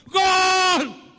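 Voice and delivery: high, long-held football cheer; goal shout. Shown as a demonstration. Preacher showing how he celebrates Bolívar's goal, joyful shout. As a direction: yell it like your team just scored. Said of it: "Grito, pues".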